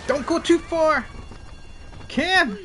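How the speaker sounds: high, exasperated calling out; hollering. Real, not acted. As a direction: holler urgently to a teammate. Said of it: "I am screaming and hollering".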